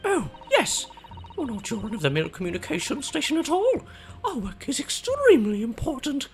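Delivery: high, proud prim lady; high pitched self-important witness. Acted. Juror character voiced.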